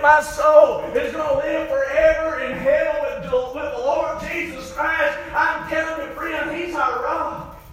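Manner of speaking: high, loud, driving pulpit delivery; full-force preaching. Real, not acted. Preacher telling friends of the soul's anchor, full-force preaching. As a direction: ring it out like a revival preacher.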